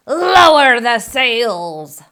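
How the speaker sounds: slow, loud order over the storm; booming storm command; captain shout. Acted. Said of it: "Braidbeard shouted".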